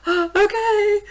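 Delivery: gasp, then very high squealed reply; breathless mock fangirl squeal; starstruck fangirl. Fan accepting a star's favor, giddy squeal. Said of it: "I'm acting, like, so stupid".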